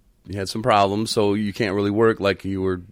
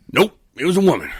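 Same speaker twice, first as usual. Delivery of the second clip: imitating Tyrone; 10 semitones higher, 6 dB louder, faster than usual. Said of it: "And you were".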